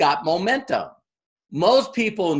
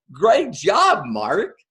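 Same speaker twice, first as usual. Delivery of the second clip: gushing praise; 2 semitones higher than usual. Retold or imitated. Friends congratulate Mark on his new business, gushing. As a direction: gush over his news. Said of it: "are like".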